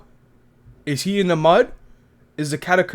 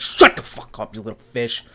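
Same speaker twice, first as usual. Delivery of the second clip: angry; 5 semitones lower than usual. Retold or imitated. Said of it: "And she's like".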